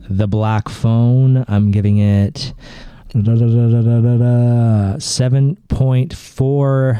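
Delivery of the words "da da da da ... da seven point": vocal drumroll. Real, not acted. Drumroll before final score.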